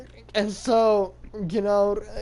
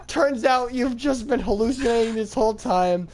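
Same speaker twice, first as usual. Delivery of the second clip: normal talking voice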